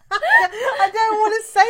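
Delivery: high, slow giggling through the words; laughing. Real, not acted. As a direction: laugh it out, embarrassed. Embarrassed about secret hack.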